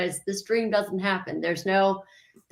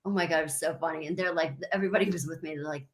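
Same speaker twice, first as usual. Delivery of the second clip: usual speaking voice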